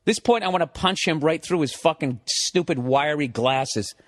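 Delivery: ordinary talk, nothing marked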